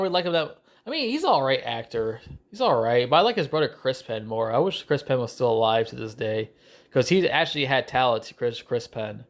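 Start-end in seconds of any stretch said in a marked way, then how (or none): none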